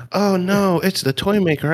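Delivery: gasp, then a high, hammed-up "oh no"; mock gasp. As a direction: play the startled victim for laughs. Playful skit, toy maker arrives.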